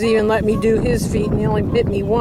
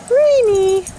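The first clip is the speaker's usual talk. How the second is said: high, loud sing-song rainy; high cheery; 10 semitones higher, 4 dB louder than usual. Acted. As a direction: chirp it brightly. Cheery word to dogs.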